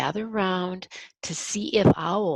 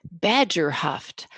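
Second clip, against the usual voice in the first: usual speaking voice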